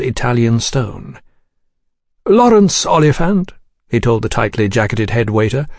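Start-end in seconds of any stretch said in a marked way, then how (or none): 2.3-3.5 high, courteous gentleman's voice; polite gentleman. Acted. Gentleman announcing himself to the head waiter, politely high. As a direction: announce yourself in a raised, polished voice.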